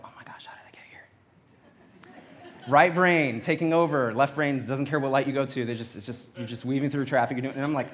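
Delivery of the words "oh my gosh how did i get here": whisper. Acted. Dazed driver arriving at work.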